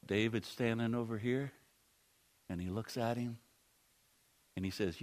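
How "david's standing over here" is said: ordinary talk, nothing marked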